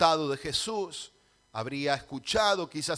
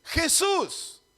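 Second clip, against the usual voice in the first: high, loud shouted plea; grito de Bartimeo; 10 semitones higher, 7 dB louder, slower than usual. Acted. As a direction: shout the title out first, then the name. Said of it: "al revés, grita". (grito agudo y fuerte)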